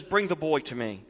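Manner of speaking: soft, gentle invitation; compassionate. Acted. Jesus gently calling the boy to him with compassion. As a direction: quietly welcome the child in. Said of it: "sense ... the compassion oozing out".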